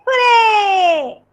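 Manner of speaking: cheerful shouted hooray. Pretend. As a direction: cheer it out for the kids. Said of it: "shout hooray".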